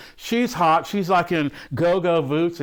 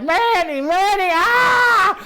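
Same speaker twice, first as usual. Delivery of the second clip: hammed-up high wail, drawn out into a scream; Shelley Winters; 13 semitones higher, 5 dB louder, much slower than usual. Imitating the actress as the ship flips, mock wailing. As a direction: cry out for a husband in mock panic. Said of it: "Shelley Winters is like".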